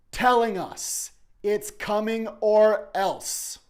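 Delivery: very high, loud, slow warning cry; impassioned cry. Real, not acted. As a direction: cry out the threat of doom. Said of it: "sermon climax".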